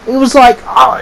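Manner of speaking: ordinary talk, nothing marked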